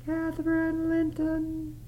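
put-on very high, slow, quivering child's voice; shivering ghost. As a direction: answer as a freezing lost girl. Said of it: "it replied shiveringly".

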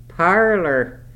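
high, loud scornful echo of the word; sneering echo. A narrator's reading. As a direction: spit the word back with contempt. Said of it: "he echoed sneeringly".

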